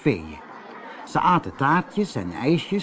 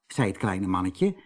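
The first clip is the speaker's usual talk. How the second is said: usual speaking voice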